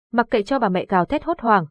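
usual speaking voice

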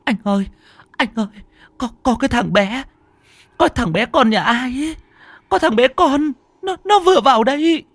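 high, panicked wife's voice; hoảng hốt. A narrator's reading. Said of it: "ôm đầu hoảng hốt". (vợ hoảng hốt lắp bắp gọi chồng)

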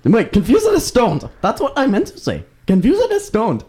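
high broken-English comic voice; mock Chinese accent. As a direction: play up the fake Chinese accent. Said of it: "See, now I Chinese".

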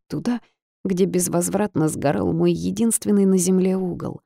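ordinary delivery

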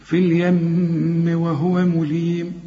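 slow recitation, long hummed m in اليم flowing into وهو; nasal hold when joined. Shown as a demonstration. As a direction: hum the m of اليم, then link on. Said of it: "وعند الوصل برضو هنغنها".

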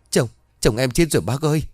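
a wife chokes out that her husband is dead; choked sobbing. Acted. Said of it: "Tiếng khóc của chị nức lên khiến cổ họng của chị như bị nghẹn lại".

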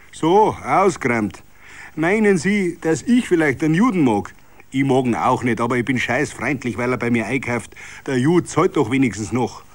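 a hint of an irritated merchant; arguing shopkeeper. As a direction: push back on him, half scornful. Beigel arguing back.

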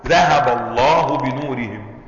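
very slow, drawn-out verse; slow Quranic recitation. Shown as a demonstration. Teacher reciting the verse for the class, very slow. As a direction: recite it word by word with reverence.

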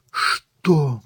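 high, shocked one-word question. Acted. Mother learns of the library, shocked. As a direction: blurt it out in high disbelief.

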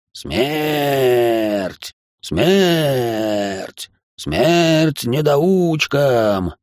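slow, ecstatic maniacal cry; mad scientist. A narrator's reading. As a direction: chant it like a gleeful madman. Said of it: "упоенно проорал тот и ещё раз расхохотался".

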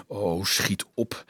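soft, low and drawn out; inward plea. A narrator's reading. Dolf silently begging the procession to hurry, soft plea. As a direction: plead slowly under the breath. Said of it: "smeekte hij inwendig".

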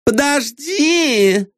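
high, drawn-out plea; high calling out. A narrator's reading. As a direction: call after him, spoiled. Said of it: "позвала она".

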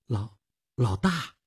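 slow, hesitant stammered question; nervous stammer. Acted. Ticket clerk hearing about the boss, nervously stammering. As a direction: stumble on the word, suddenly unsure.

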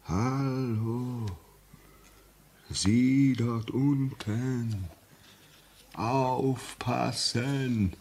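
very slow, drawn-out, hollow warning; ghostly call. Retold or imitated. Signalman repeating the ghost's warning call, slow and eerie. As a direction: echo the ghost's cry from far away.

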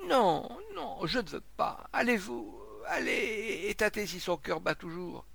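high-pitched, distraught refusal; panicked pleading. A narrator's reading. Sick man begging the crowd to check a heart, panicked. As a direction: beg them in mounting dread.

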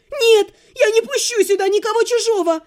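high, loud hysterical yell; girl screams for the king amid the chaos; shouting demand. A narrator's reading. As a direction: demand the king at the top of her voice. Hysterical refusal.

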